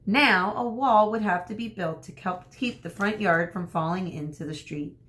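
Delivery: ordinary talk, nothing marked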